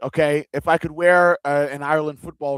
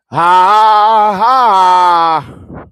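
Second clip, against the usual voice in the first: loud, higher mock ha-ha; hearty laugh; 6 semitones higher, 12 dB louder than usual. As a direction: laugh it out big. Hammed-up laugh after singing.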